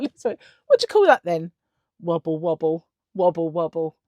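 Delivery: cheeky jeer at the fat man's belly; taunting. Retold or imitated. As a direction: tease him with a singsong wobble. Mocking the fat man's wobble.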